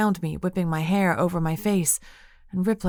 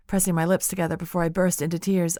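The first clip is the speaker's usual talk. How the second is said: plain reading voice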